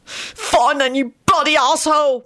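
high, heated angry shouting. A narrator's reading. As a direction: shout back furiously in a high-pitched voice. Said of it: "William shouted".

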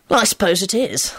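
mildly thoughtful, musing reply; thoughtful Lupin. A narrator's reading. Lupin conceding a point to Elizabeth, thoughtfully. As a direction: weigh it up as you answer. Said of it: "Lupin replied thoughtfully".

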